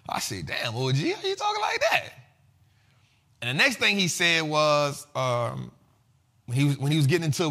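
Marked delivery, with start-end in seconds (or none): 0.5-2.1 rising way higher in mock astonishment; mock amazed, voice leaping high; amazed reaction. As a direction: play up the disbelief, pitch jumping up. His own amazed reply, retold.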